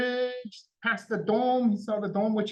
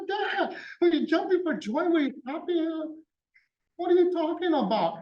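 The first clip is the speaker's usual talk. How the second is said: excited, much higher, questions tumbling out with a laugh; amazed high-pitched questions; 8 semitones higher than usual. Retold or imitated. Daughter marvelling at her father's news, high and excited. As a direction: pitch right up and half-laugh through the disbelief.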